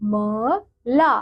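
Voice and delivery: ग म ला stretched very slowly; slow sounding out. Shown as a demonstration. Teacher showing children how to read it. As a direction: drag each syllable of गमला. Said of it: "तो हम क्या बोलेंगे इसे?".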